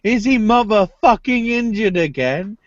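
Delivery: high-pitched incredulous demand; exasperated. Real, not acted. Demanding to know if he's injured again, exasperated.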